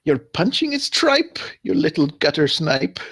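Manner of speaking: high, slow, theatrical taunt; taunting insult. Acted. Player's bard mocks the attacker who missed, taunting. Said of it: "And I say".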